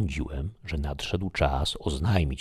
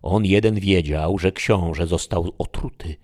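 loud, dismayed outburst; agitated exclamation; 3 semitones higher, 7 dB louder than usual. Acted. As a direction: blurt it out in alarm. Dama wzburzona odkryciem tajemnicy. (wzburzony, głośny okrzyk)

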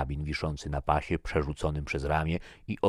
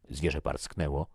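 ordinary delivery

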